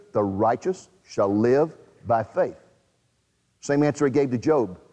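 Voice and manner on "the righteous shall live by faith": the righteous shall live by faith, every word weighed, lower and slow; slow solemn emphasis. Shown as a demonstration. Preacher giving God's answer, slow and solemn. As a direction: let it land with weight.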